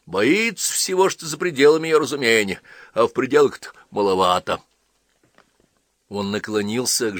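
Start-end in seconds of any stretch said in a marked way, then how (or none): 0.1-4.6 wry explaining. A narrator's reading. Husband explains wife's fright.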